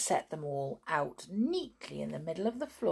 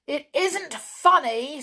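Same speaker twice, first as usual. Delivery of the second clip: higher, louder, slow indignant protest; angry; 9 semitones higher, 8 dB louder than usual. A narrator's reading. Tom objects to being laughed at, angry. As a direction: protest crossly, drawing the words out. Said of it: "said Tom angrily".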